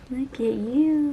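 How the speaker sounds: dote on him gently. Real, not acted. Cooing at cat on camera.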